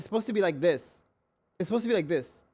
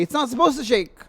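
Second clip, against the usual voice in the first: higher-pitched indignant insistence; exasperated protest; 6 semitones higher, 4 dB louder than usual. Real, not acted. Complaining about the shaky desk, exasperated. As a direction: protest it with raised voice.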